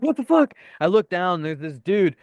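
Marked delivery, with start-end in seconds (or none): 0.0-0.5 high, loud retold alarm; startled outburst. Storyteller woken by shaking bed, retold startled yelp. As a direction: jolt awake, pitch shooting up. Retells waking startled in the dorm.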